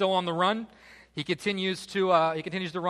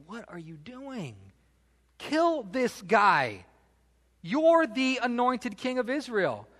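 high, drawn-out disbelief building to loud insistence; incredulous urging; 8 semitones higher, 4 dB louder, slower than usual. Acted. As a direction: act out men egging their leader on. Acts David's men urging him on.

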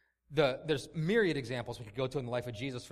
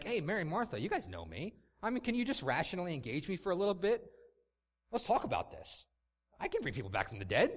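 high, syrupy sarcastic reassurance, pitched up; mock soothing Jesus; 6 semitones higher, faster than usual. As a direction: parody a preachy, patronizing comforter. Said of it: "and be like".

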